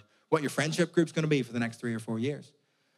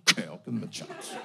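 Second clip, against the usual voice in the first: reluctant agreement; 6 semitones lower, 4 dB quieter than usual. Retold or imitated. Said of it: "I was like".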